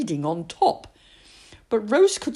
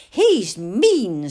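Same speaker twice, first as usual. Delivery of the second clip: put-on a child's voice, declaring it; voiced child, blunt; 2 semitones higher, 7 dB louder than usual.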